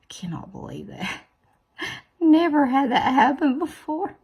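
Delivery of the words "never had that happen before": high, loud laughing disbelief. Real, not acted. Laughing at her own nail mishap to viewers. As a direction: laugh it out, unable to believe it.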